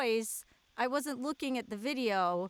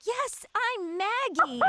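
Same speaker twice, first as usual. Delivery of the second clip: mocking mom's reply; 8 semitones higher, slower than usual. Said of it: "And you're like".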